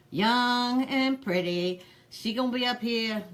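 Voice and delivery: slow, sighing mock admiration; sighed, drawn-out sneer; sighing scorn. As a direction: drag it out with a weary sigh. Sighing scorn at the pretty daughter.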